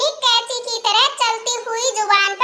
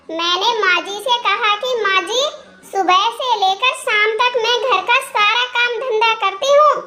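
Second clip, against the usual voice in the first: usual speaking voice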